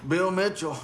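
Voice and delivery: high, loud name called out; calling a name. Retold or imitated. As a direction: greet a stranger by name. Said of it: "the guy comes up and goes like".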